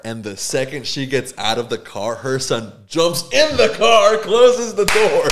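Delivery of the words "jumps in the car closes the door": high, slow, loud laughing narration; can barely get it out for laughing; building laugh at the punchline. Real, not acted. Laughing at son's escape.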